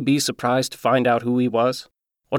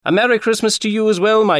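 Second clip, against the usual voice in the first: raised voice calling after her; 8 semitones higher, 4 dB louder than usual. Acted. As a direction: call it across the street to the girl. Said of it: "I called out to her one more time".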